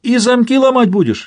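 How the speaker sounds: high, cheerfully astonished question; amused high-pitched disbelief; merry amazement. A narrator's reading. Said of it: "ещё веселее изумился Самсон".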